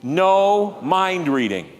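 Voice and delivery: "No mind reading" slow, leading the room; slow emphatic. Shown as a demonstration. Preacher repeats the slogan with the congregation, slow. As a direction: say it with them slowly. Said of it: "Can you say that with me".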